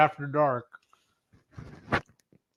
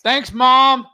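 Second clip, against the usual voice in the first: loud, high playful yell of "Thanks, Mom"; 10 semitones higher, 10 dB louder than usual. Real, not acted. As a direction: shout "Thanks, Mom" across the room. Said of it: "And I yelled out".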